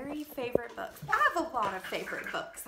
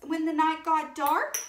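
high, wailing servant voice; panicky page; 9 semitones higher, 5 dB louder than usual. A narrator's reading. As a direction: fret and wail like a worried page. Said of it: "cried the page".

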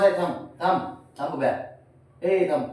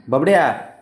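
loud call of the cat's name; 2 semitones higher, 9 dB louder than usual. Real, not acted. Calling the cat by name.

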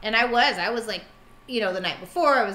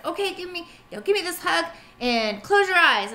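higher, gentle coaxing voice; coaxing nurse; 8 semitones higher, 3 dB louder than usual. Retold or imitated. Nurse calming the boy before the shot, gently coaxing. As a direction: soothe a child into closing his eyes. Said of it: "she said".

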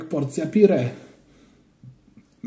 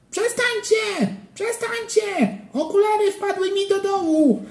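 put-on crying child yelling. A narrator's reading. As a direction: wail it like a desperate little boy. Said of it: "a Ananiasz się darł". (wysoki, płaczliwy krzyk dziecka)

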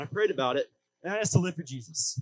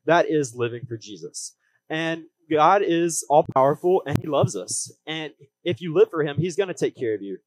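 upbeat youth pastor; 5 dB louder than usual. Acted. Preaching with growing force.

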